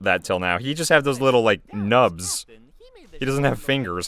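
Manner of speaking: usual speaking voice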